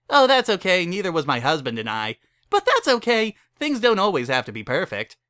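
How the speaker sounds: high, loud, eager wedding-chapel host; cheery chapel keeper. Acted. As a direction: sell them a wedding with pushy cheer. Barry reassures the stallions.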